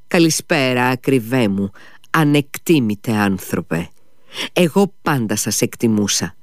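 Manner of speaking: usual speaking voice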